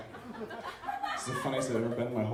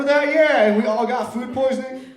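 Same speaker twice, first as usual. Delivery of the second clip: much higher and louder, building; loud mock nostalgia; 8 semitones higher, 6 dB louder than usual. Comic yelling old memories at the crowd, louder and higher. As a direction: shout the memory to the whole room.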